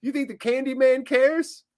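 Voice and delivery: high mock-incredulous jeer. Host mocking chat users for dropping the D, high jeering voice.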